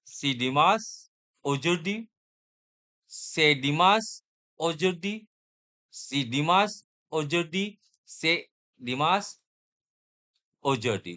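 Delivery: सी डिमास उजुर्दी said slowly with a rise on डिमास; slow teacher's model of सी डिमास उजुर्दी; sentence drill. Shown as a demonstration. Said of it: "कौन सा sound आपको perfect लग रहा है?".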